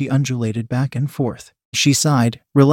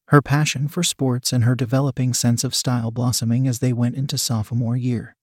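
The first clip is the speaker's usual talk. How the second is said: usual speaking voice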